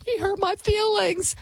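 high-pitched whiny crybaby voice; mock crying. Host mocks easily upset young newsroom staff, mock crying. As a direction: mimic a wounded youngster, high and tearful. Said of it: "and they wanna cry".

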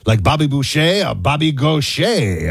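Boucher and Gaucher slowly, as boo-SHAY and go-SHAY; drawn-out Cajun Boucher, Gaucher; Cajun pronunciation. Shown as a demonstration. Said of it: "that's like a Cajun".

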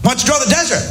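high, bro-ish heckler call; shouting bro. Retold or imitated. Heckler in the club yelling at the comic, high mock bro. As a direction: call it out like a dude bro at the back. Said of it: "shouted out from the back".

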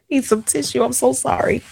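tearful, laughing through tears; real tears, apologetic; crying. Real, not acted. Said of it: "this is so ugly".

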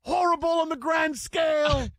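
put-on grand, shouted English pundit accent. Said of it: "Should I do this in an English accent".

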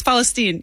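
"Palestine" said as "Falasteen", F in place of P; Arabic pronunciation, no P. Shown as a demonstration. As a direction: start "Palestine" on an F and end it "-teen". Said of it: "there's no P in Arabic".

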